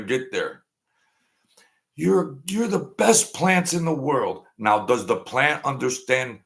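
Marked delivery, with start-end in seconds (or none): 2.0-4.4 high, sweet praise voice; praising the plants. Pretend. Man in an experiment praising the plants, high and sweet. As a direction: coo it up high like praising a baby.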